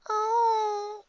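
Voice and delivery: high, whimpering "oh"; pained moan. A narrator's reading. Pony with an aching forehead from snow, pained moan. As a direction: moan in a small, hurt voice. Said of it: "I moan".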